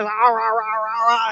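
mock chomping goat sounds; goat chomping noise. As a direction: gnaw at it like a greedy goat. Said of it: "and the goat's just".

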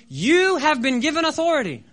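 loud, high, commanding proclamation; voice of the Lord. Retold or imitated. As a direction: declare it firmly from on high. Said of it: "I hear the Lord say".

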